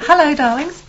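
high, loud gushing dame greeting; Dame Edna Everage. Retold or imitated. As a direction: coo it like a flamboyant dame. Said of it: "why is everybody Dame Edna Everage today?".

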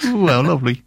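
sing-song Welsh accent. Shown as a demonstration. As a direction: give it a Welsh lilt. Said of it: "in a Welsh accent".

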